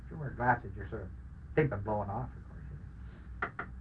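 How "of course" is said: normal talking voice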